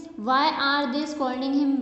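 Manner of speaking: word by word, very slow and clear; slow classroom read-aloud; slow dictation. Shown as a demonstration. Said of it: "teacher reads sentence aloud".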